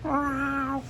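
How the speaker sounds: high, soft imitated cat meow. Shown as a demonstration. Comedian demonstrates his cat trick, high cat meow. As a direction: mew like a little cat, very high. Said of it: "And it's a cat".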